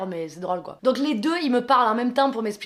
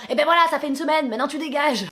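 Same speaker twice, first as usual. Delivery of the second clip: higher, louder, fast dismissal; mock angry order; 5 semitones higher, 3 dB louder, faster than usual. Neighbour ordering the woman to leave: fast, louder. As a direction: throw her out in a rush.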